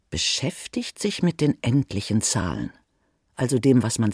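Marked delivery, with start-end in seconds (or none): none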